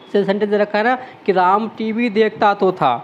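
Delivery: ordinary delivery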